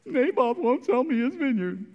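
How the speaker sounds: a touch of a high sulky whine; whiny complaint. Retold or imitated. Ahab to Jezebel, a lightly whiny complaint. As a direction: pout and complain. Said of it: "He cries like a little girl".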